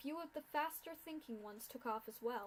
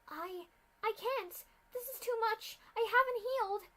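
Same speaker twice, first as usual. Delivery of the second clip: high, loud, stammering panic; panicked refusal; 11 semitones higher, 7 dB louder than usual. Acted. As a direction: falter then cry out. Injured Aurora refuses, panicking.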